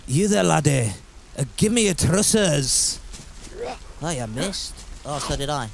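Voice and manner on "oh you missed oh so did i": usual speaking voice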